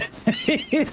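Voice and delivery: high squealing laugh; a sudden high cackle of delight; real squeal of laughter; burst of laughter. Real, not acted. Laughs at the clip.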